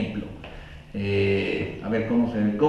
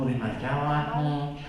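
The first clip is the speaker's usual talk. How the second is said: too quiet again; 6 dB quieter than usual. Shown as a demonstration. Speaker repeating the too-soft voice demo, softer. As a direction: say "o demasiado bajo" softly, trailing off. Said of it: "el tono de tu voz es demasiado al".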